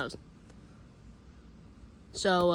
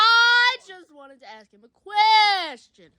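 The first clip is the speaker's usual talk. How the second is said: loud, high, slow insistent protest; mock protest; 8 semitones higher, 9 dB louder, slower than usual. Talking over Ben's echo, loud slow protest.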